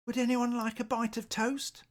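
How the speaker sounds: high, friendly little owl. Acted. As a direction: offer it brightly, high up. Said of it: "he said".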